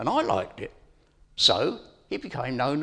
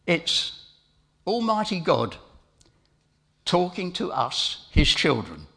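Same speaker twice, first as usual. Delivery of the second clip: usual speaking voice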